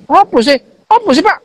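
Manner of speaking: mock a kid talking back to the teacher; defiant retort. Boy sassing the headmaster, rising defiant mock retort. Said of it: "Gitu, gitu".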